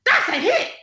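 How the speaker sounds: very high, excited call from the crowd; dad blurting out praise in church. Retold or imitated. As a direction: voice the dad shouting approval mid-worship. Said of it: "all you hear ... is".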